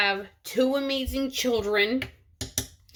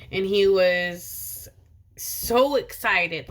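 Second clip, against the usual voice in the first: ordinary talk, nothing marked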